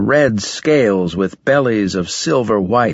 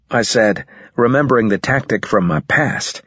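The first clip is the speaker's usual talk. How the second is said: usual speaking voice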